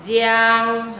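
"giang" drawn out high into a humming ng; nasal ending. Shown as a demonstration. Teacher explains the nose sound, long nasal "giang". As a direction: stretch "giang" through the nose. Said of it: "Âm này kết hợp âm sống mũi".

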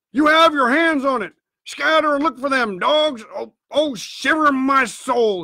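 high, agitated exhortation; frustrated cry. A narrator's reading. Blind man urges crew to search, crying out in frustration. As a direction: drive them on in desperation. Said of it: "he cried".

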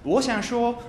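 ordinary talk

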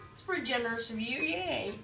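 normal talking voice

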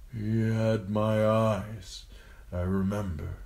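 slow, wispy dying father's voice; thin, pained murmur. A narrator's reading. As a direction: draw it out weakly through pain. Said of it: "thin and wispy and racked by pain".

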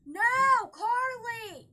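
loud angry yell at sister; angry shout. Real, not acted. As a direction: shout her name to stop her. Sister grabs her tablet.

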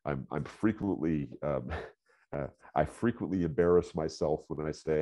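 ordinary talk, nothing marked

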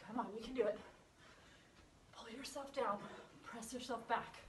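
ordinary delivery

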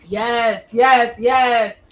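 loud, elated host applauding a performer; excited cheering. Real, not acted. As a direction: cheer the poet with full-voiced approval.

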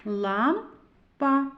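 "Лампа" as "Лам", pause, "па"; syllable by syllable. Shown as a demonstration. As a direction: read "Лампа" in two separated syllables. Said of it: "Прочитаємо".

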